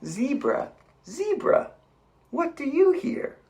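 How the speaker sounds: refrain high and loud. A narrator's reading. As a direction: keep the zebra pitch in the refrain. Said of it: "refrain after zebra".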